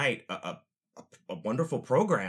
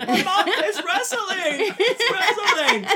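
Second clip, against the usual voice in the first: very high, very slow, louder laughing whine; whiny pleading kid; 9 dB louder, much slower than usual. Retold or imitated. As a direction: beg mom like a kid through laughter.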